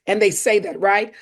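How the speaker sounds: ordinary talk, nothing marked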